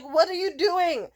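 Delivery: high, loud, drawn-out disbelief; exasperated scolding. Retold or imitated. Friend scolding a drunk heckler, high exasperated shout. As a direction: demand an answer, slowly and loudly.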